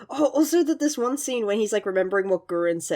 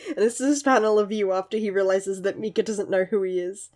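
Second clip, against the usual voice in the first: ordinary talk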